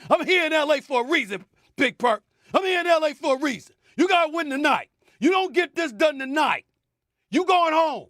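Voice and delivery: fired-up high-pitched plea; urgent rallying call to the team; heated pundit debate. Real, not acted. Pundit fired up, addressing Lakers.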